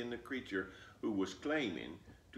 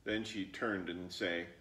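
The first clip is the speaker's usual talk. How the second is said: ordinary delivery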